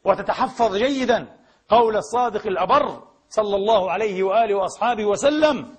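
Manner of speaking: ordinary delivery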